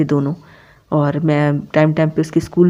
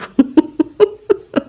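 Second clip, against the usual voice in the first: amused laugh at a cheeky friend; real laugh; 10 semitones higher than usual. Real, not acted. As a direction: let the laugh break out high.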